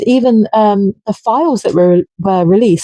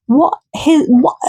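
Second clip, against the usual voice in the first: disbelieving what; 7 semitones higher than usual. Real, not acted. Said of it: "What is he fucking doing?".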